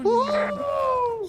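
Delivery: excited whoop. Real, not acted. Cheering in icy cave.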